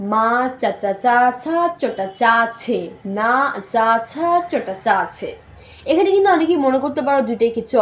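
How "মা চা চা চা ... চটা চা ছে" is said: slow, careful মা চাচা চা ছা; slow tongue twister. Shown as a demonstration. Teacher slowly modelling the twister for learners. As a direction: spell out চা and ছা slowly and distinctly. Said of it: "দ্বিতীয় tongue টুইস্টারটা হলো".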